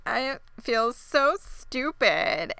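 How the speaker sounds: slow, put-on weepy whine; milk the fake tears; fake crying. Callie fakes tears to fool Kevin, slow weepy whine. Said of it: "She starts crying".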